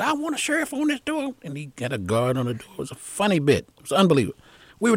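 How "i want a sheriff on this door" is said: very high-pitched command; imitated alarmed order. Retold panicked order to guard door.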